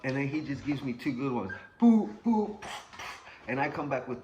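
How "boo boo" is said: punch noises. Retold or imitated. Imitating punches.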